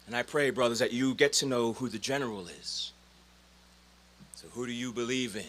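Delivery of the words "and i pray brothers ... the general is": ordinary delivery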